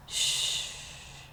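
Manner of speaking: soft unvoiced shush; calm him like a scared kid; girl hushing her crying father. A narrator's reading.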